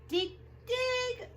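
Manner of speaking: eager little digger; excited dig. Acted. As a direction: dig dig jumping high. Eager digging.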